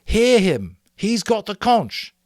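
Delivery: high, loud, slow command; shouting. A narrator's reading. Ralph to the assembly, shouting for order. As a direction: call the meeting to order. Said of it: "Ralph shouted".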